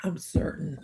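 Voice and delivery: dry whispered aside; deadpan it under your breath. Real, not acted. Whispering to viewers about her son's germs.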